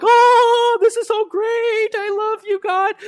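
very high-pitched drawn-out gush; over-the-top squeal of adoration; gushing mock rapture. Imagined readers gushing over God's promise, mock rapture. Mocking gushing reaction to scripture.

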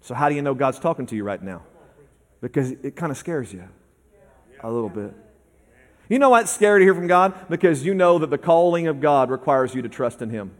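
quiet, intimate preaching; soft sermon voice. Acted. Preacher speaking gently to the congregation, quiet and intimate. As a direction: draw the congregation in softly.

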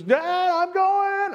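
calling back eagerly while riding off; excited kid. Retold or imitated. Father imitating his son riding ahead, excited. Child voiced in father's story.

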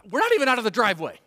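high, loud, rushed protest; exasperated parent. Retold or imitated. Dad reacting to screams before leaving the driveway, exasperated. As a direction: rattle it off like a fed-up dad.

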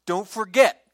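loud, pressing warning; stern warning. Acted. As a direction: drive the warning home. Moses warns the people.

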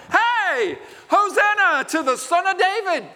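put-on high, loud, bright call of a child; joyful children's cry. Preacher voicing children praising Jesus, high and loud. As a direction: cheer it like an excited child.

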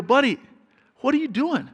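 high, disbelieving call to his son; a retold, amused what-on-earth question; incredulous dad. Dad to son getting crushed at basketball, high incredulous.

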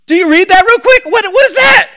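high, loud disbelief; hammed-up bewilderment rising in pitch; incredulous. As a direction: demand it in baffled amazement. Mock astonishment at the verse.

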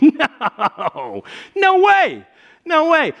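high, loud mock astonishment; acted disbelief. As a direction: cry out in exaggerated disbelief. Retells his disbelief to wife.

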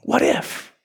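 breathy hushed whisper of the question; a whisper that lets the question hang. Real, not acted. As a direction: drop to a bare whisper.

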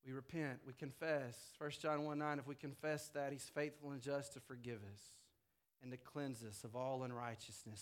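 hushed pastoral reassurance; soft reassuring close. Acted. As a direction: ease the listeners down to rest in grace.

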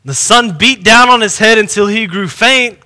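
ordinary talk

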